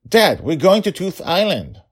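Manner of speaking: put-on high, loud protesting kid; exasperated child. Daughter reminding forgetful dad of the plan, exasperated. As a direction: insist loudly, pitch jumping up.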